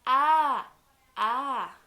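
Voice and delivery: loud cried Ah; mock shout. Retelling in Spanish, kids shout at the ants. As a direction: cry out like bitten by ants.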